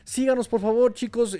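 loud, high street-vendor pitch; hyped fairground barker. Real, not acted. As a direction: hawk it like a market barker. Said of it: "estoy aquí bien como merolico".

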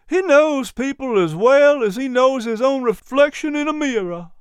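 chirpy little dog quoting Patrick; high, eager dog voice; little dog character voice. A narrator's reading. Miz P explains.

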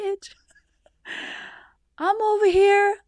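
high, squeaky crying imitation; slow, high-pitched retold blubbering; mock tearful. Patient hearing she is clear after weeks of fear, mock tearful. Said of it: "And I just started crying".

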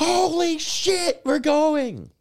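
high, loud, stretched-out victory screech; yelling. Retold or imitated. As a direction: scream it like an overjoyed fan. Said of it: "yelling and yelling and yelling".

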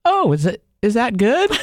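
high, innocent imitation of the wife; mock clueless wife. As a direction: ask it sweetly, no idea what it means. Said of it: "She's like".

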